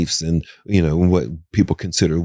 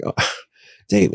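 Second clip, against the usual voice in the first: exasperated sigh; 12 semitones higher than usual. Retold or imitated. Exasperated quoted reply.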